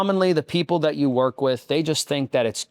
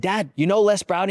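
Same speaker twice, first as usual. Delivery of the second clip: eager son; 7 semitones higher than usual. Retold or imitated. Son calling dad.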